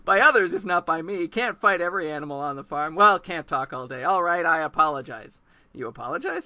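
put-on a loud, blunt old owl, unbothered. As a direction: deliver it flatly blunt, like a know-it-all. Owl answers Grover.

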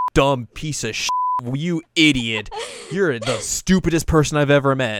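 playfully acted angry tirade; mock berating. Acts Kagome insulting him.

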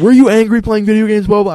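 high, loud mocking dad voice; accusing dad. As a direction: needle him like a suspicious father. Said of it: "he was like".

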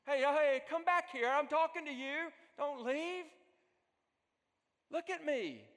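loud, high urgent shout; calling after someone. Acted. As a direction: yell after someone walking away from you. Acted calling after someone walking away.

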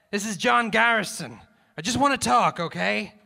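megaphone call. A narrator's reading. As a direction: call out to him across the distance. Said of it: "Garrison grasped the megaphone".

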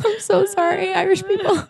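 high, helpless laughter through the words; squeaky with laughter; laughing apology. Real, not acted. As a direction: apologize while cracking up. Laughing apology for bad accent.

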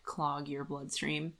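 ordinary talk, nothing marked

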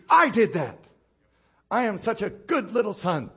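a hint of a proud child bragging; smug flesh boasting. Preacher voices the boasting flesh, lightly smug. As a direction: preen like a self-satisfied good boy.

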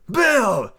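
loud, high call of the name. Acted. Stranger hailing the captain, loud and bold. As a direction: bold hail across the room.

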